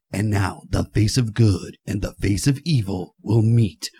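deep, slow movie trailer narrator; booming nineties trailer announcer. Retold or imitated. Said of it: "now we get the big trailer voice".

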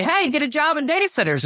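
high, bright sales pitch; cheerful pitch. Retold or imitated. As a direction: pitch it eagerly. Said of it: "You say".

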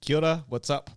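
Kia ora said as a Maori greeting, kee-ah OR-ah with the fall on ora. Shown as a demonstration. Greeting the host in Maori with Kia ora, falling on ora. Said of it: "I'm speaking Maori today".

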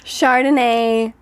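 put-on drawn-out Chardonnay, playful English accent. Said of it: "In an English accent".

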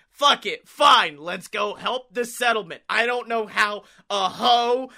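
usual speaking voice

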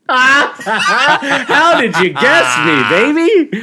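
high, loud laughing exclamation. Real, not acted. Host reacts to co-host's correct guess, laughing hard. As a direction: crack up with real, high-pitched laughter.

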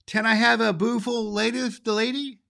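put-on high, baby-talk child's voice, eager; a toddler begging for the pretty doll; high eager little girl's plea. Mary asking for the doll.

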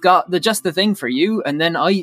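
broad West Country yokel, chatty and rambling; a rustic West Country beekeeper voice; West Country accent. Acted. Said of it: "really love your West Country accent there".

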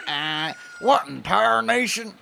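put-on high, drawling old prospector voice. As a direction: grumble like a cranky old miner. Reads old rancher dialogue.

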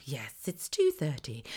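slow, soft mock operator voice; measured, hushed telephone answer. Operator telling prank caller the time, slow and soft.

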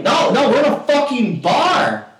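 high, loud, slow-stretched refusal; retold angry protest. Player refuses bar rules, loud exasperation. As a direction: hammer out each word in exasperation. Acted quarrel over pool rules.

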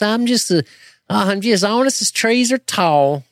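high, slow cartoon Southern twang; exaggerated fake Southern. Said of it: "they do it like this".

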